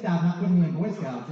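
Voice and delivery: ordinary delivery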